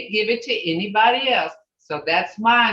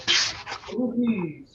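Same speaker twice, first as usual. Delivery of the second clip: slow, softer, drawn-out tired demo; 6 dB quieter, slower than usual. Shown as a demonstration. Teacher telling Judy she's tired, slow tired tone. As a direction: drag it out as if tired. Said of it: "Like you're tired".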